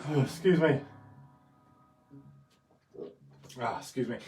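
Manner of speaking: hiccups. Real, not acted. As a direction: excuse me between hiccups. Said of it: "before I die, of hiccups".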